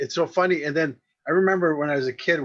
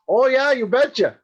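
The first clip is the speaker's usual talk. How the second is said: high, loud mock Minnesotan; Minnesotan accent; 11 semitones higher, 9 dB louder than usual. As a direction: imitate an over-the-top Minnesotan, loudly. Retold trainee's Minnesotan catchphrase.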